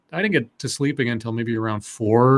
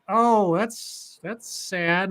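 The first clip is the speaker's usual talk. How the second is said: pitying; 4 semitones higher, 5 dB quieter, much slower than usual. Retold or imitated. Own reaction to the screaming.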